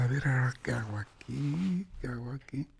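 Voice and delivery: whispered, lost and uneasy; man trapped alone in the dark church whispering; worried whisper. Acted. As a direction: whisper the question to yourself.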